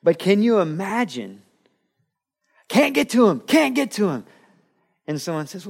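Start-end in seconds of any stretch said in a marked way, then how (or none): 2.7-4.2 high, loud anguished repeat; a frantic father locked out; desperate pleading. Retold or imitated.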